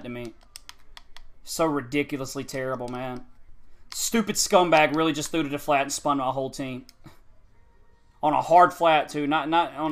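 low, soft, bitter anger; real simmering rage; seething disbelief. Real, not acted. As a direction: hold the fury down while insulting him. Seething at the opponent.

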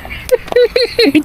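squealing burst of laughter; high laugh. Real, not acted. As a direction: squeal with delight. Laughing after the prank.

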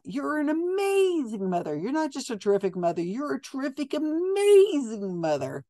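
high, syrupy mock praise, pitched well up; mock gushing medium. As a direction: gush over her like the medium does. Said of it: "what is this nonsense with".

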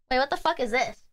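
usual speaking voice

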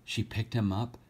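lively storybook reading, rhyming lilt; story reading. A narrator's reading. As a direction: read the verse aloud to children with feeling. Rhyming story read aloud.

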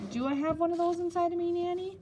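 put-on high-pitched child's question; curious child. A narrator's reading. Young boy questions his nanny about the appendix, curious and high-pitched. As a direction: ask high, rising toward the end.

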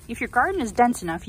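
normal talking voice

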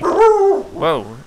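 loud high startled whoa; surprised. Real, not acted. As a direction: yelp in real surprise. Sudden shock in game.

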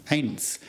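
"Eins" with an "eye" vowel and a "ts" ending; German Eins. Shown as a demonstration. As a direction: say "Eins" as "ints" with a long "eye" sound. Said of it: "Notice that the E I sound here is an A sound".

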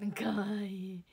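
大きく弾む「かわいい」; 思わず声が大きくなる感じ; 思わず可愛がる声. Real, not acted. 扇風機の前で動かない猫. (可愛さに声を上げる)

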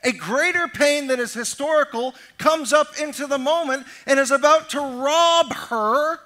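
loud, rising preacher's cadence; preaching at full force. Acted. Preacher pressing the congregation on hurt and offense, at full force. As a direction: drive the point home to the congregation.

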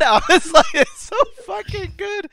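high, laugh-choked delight. Real, not acted. The speaker loving the scene, laughing high. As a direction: squeal it through laughter.

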